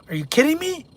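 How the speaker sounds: high-pitched disbelief; incredulous. Real, not acted. Incredulous reply to political question.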